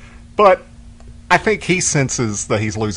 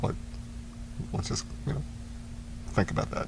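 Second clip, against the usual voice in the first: unvoiced and soft, almost a whisper; hushed; 4 semitones higher, 14 dB quieter than usual. Denzel calming the crew after a death, whispered. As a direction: murmur it gently over the mic. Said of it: "kinda like".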